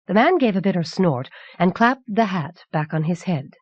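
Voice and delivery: plain reading voice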